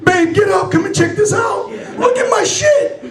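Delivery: high, urgent calling out; acted frantic plea, pitch climbing; comic panic. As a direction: summon help in a mock panic. Calls partner urgently to look.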